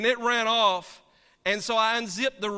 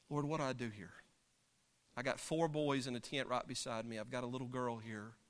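anxious inner prayer; 8 semitones lower, 12 dB quieter than usual. Retold or imitated. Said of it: "I'm thinking".

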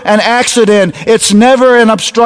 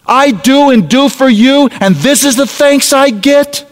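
higher, raised, far more indignant imitation of a scolding parent; mock angry parent; 6 semitones higher than usual. Parent scolding his lying teenage son, in mock indignant anger. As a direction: play the outraged parent in his face. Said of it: "get in his face and say".

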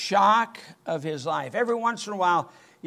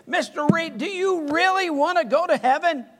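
earnest plea; 11 semitones higher, 4 dB louder than usual. Retold or imitated. Preacher pleads with Joe.

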